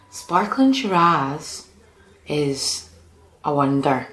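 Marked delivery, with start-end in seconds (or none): 0.1-1.7 slow, dreamy "Sparkling Shiraz"; swooning over the wine; savoured name. Acted. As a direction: linger on the words like tasting them.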